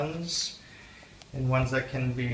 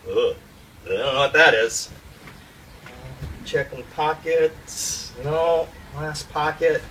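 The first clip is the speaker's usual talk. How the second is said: ordinary talk, nothing marked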